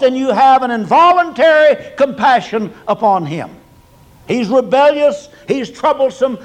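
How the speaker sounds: loud, high-pitched pulpit fervor; fervent preaching. Acted. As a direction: preach it out with rising heat. Preaching at full force.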